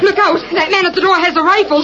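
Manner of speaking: high, urgent cry; alarmed warning. Acted. Woman warns rescuer of the man with rifle, alarmed.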